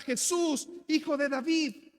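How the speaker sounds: high, loud desperate call; shouting out. Acted. Beggar shouting to Jesus passing by, loud desperate call. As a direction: shout it over a crowd. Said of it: "empieza a gritar".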